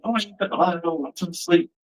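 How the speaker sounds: soft, high-pitched mock whine; irritated. Son in bed when mom flips the light on, mildly irritated. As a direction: imitate a sleeper complaining at being woken. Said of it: "the first thing I want is get mad".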